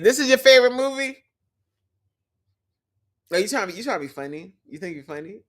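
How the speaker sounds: ordinary delivery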